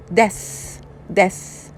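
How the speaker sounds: normal talking voice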